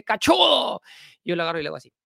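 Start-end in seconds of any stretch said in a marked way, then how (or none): 0.0-0.8 high, loud mock yell; retold street heckle, shouted high; imitated shouted insult. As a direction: yell it like an angry passerby, drawn out. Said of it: "me grita y me dice".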